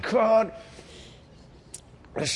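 usual speaking voice

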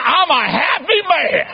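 high, slow, drawn-out imitation of elation; excited old father. Father announces his wedding to his son, hammed-up elation. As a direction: crow it like a newlywed old man. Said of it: "He's excited".

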